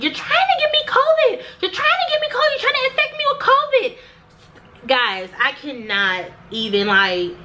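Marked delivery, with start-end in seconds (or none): 0.0-3.9 very high, shrieking imitation of the accusing receptionist; hammed-up shrill yelling of the covid accusation; mock hysterical yell. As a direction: screech the accusation in mock panic. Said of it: "She starts yelling".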